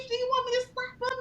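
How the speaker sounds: high, quick playful threat; egging a friend on; eager mock offer. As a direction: offer to slap someone, hyped. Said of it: "I'd be like".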